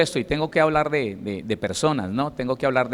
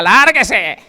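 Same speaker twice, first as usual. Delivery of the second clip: loud, higher snapped "get out"; preacher acting a wife yelling at her husband, higher and louder; mock shout; 6 semitones higher, 10 dB louder than usual. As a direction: bark it at him like a scornful wife.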